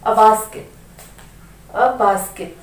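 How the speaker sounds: "a basket" flowing straight into basket; linked as one word. Shown as a demonstration. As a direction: glue "a basket" together. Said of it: "बिल्कुल एक word जैसे pronounce करेंगे". ("a basket" एक शब्द जैसा जोड़कर)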